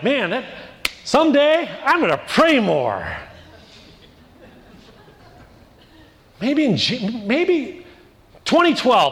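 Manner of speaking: hammed-up someday-I'll-pray voice; mock procrastinator. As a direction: parody someone putting off prayer.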